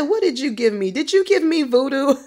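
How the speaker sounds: high, incredulous retold questions; loud-edged, high astonishment; bewildered questioning. Acting out his bewildered questioning.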